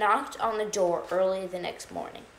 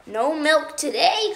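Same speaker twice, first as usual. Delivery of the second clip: higher, louder and slow, a dismayed cry; 6 semitones higher, 5 dB louder than usual. Acted. Farmer Brown crying out at the note, loud and slow. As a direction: wail it like a farmer losing his milk. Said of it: "cried the farm, cried Farmer Brown".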